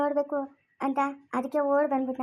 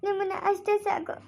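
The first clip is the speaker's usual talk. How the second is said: choked-up, higher-pitched sobbing voice; tearful; 5 semitones higher than usual. Acted. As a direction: speak through tears to long-missed parents. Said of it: "ಮತ್ತೆ ಯಾಕೆ ಅಳ್ತಾ ಇದ್ಯಾ". (ಅಳುತ್ತಾ, ಗದ್ಗದಿತ ದನಿಯಲ್ಲಿ)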